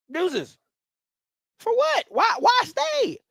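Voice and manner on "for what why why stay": high, slowed-down disbelieving shout; incredulous shout. Real, not acted. Host yelling in disbelief at viewers, high and slow. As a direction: shout it like the idea is absurd.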